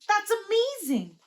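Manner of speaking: put-on high, thrilled little girl; amazed. Little sister amazed by the lightning, high pitched. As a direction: sound dazzled by the sky, high.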